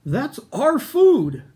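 high, loud, slow protesting yell. Acted. Apatosaur yells at the Minosaur stealing snacks, loud. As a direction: shout it to stop the thief. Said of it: "yelled Apatosaur".

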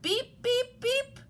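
high, quick horn beeps; truck beeps. A narrator's reading. Everybody hears Blue's call, high beeps. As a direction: imitate the little truck's beeps. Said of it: "Everybody heard that".

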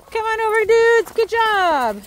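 high sing-out to the dogs; calling dogs over. Real, not acted. Walker calls the dudes over, high-pitched praise. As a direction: call them in, high and encouraging.

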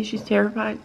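ordinary talk, nothing marked

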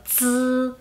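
"z" as a held, buzzing dz with no puff of air; Mandarin Z. Shown as a demonstration. Teacher showing learners how Z sounds alone, "z" as a vibrating dz. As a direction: say "z" like the end of English kids, drawn out. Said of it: "ese sonido".

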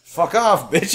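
ordinary talk, nothing marked

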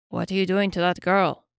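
lightly voiced hefty troll making a demand. A narrator's reading. Troll challenges an elf over a captive girl, demanding. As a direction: challenge them in a slightly put-on troll voice. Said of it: "demanded a hefty troll".

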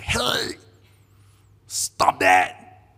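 sharp call dropping into a stern command; angry scolding. Retold or imitated. Camp leader grabbing an obnoxious kid, angry scolding. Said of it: "I just grabbed him by the shirt".

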